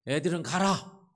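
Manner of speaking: put-on loud, high, drawn-out bellow driving the kids off; angry shout. Disciples angrily chasing the children away, loud high shout. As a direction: roar at the children to get lost. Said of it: "그리고 화를 내면서".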